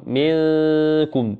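منكم with the nun hidden in a long nasal hum, very slow; منكم drawn out, nun sounded between clear and merged; ikhfa demonstration. Shown as a demonstration. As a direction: stretch منكم slowly, the nun hidden, neither touching nor dropping. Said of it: "نقول".

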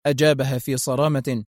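usual speaking voice

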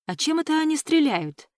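pitched high in puzzled surprise; high, wondering question; surprised question. A narrator's reading. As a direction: ask it in astonishment, voice lifted. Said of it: "удивлённо спросила Алекс".

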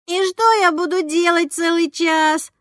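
put-on a bored kid whining about the wait; whining boy. A narrator's reading. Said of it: "заныл Гуся".

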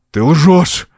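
exhaled accusation. A narrator's reading. Count to the girl, angry gasp. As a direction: hurl the accusation out. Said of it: "выдохнул он".

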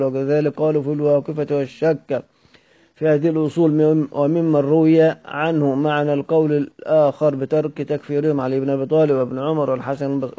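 ordinary talk, nothing marked

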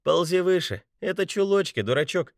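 teasing laugh. A narrator's reading. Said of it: "хохотнула Бетти".

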